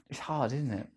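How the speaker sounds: normal talking voice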